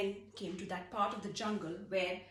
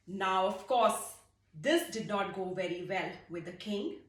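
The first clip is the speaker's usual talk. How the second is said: plain reading voice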